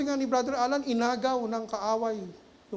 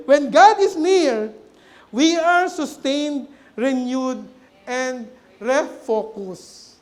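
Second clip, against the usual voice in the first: slow, louder, emphatic preaching; measured pulpit proclamation; 2 semitones higher, 10 dB louder, much slower than usual. Acted. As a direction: drive each phrase home to the congregation. Preaching at full force.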